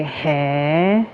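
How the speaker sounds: "Hey" drawn out with a dip and a rise; Japanese-style reacting "hey". Shown as a demonstration. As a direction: say "Hey" with a telling tune. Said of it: "that tone can change".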